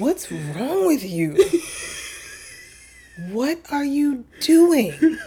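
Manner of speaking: slow, drawn-out disbelief; exasperated parent. Acted. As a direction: stretch each word like a baffled parent. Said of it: "every parent of boys has that feeling like".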